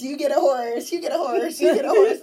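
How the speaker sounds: mock game-show giveaway, building up; building excitement.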